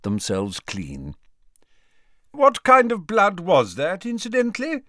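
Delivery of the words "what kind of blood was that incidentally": raised high voice over noise; loud, high-pitched casual inquiry. A narrator's reading. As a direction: call the question out over the din. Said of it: "asked Dumbledore loudly".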